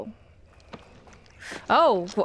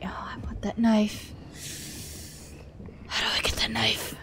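hushed whisper; 3 semitones lower, 5 dB quieter than usual. Real, not acted. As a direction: whisper it under your breath. Sneaking, whispering to self.

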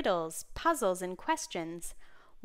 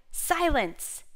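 loud, raised, one-word command; shouted order; 6 semitones higher, 6 dB louder than usual. A narrator's reading. King to the buzzing insects, shouted order. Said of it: "when King Solomon shouted".

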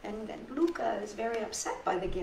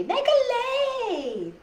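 much higher, slow sing-song invitation; cheerful lady; 5 dB louder, much slower than usual. Acted. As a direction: stretch the words out like a kind helper coaxing a child. Lady invites kids to lei table.

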